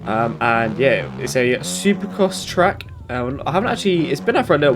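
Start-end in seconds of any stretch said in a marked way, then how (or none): none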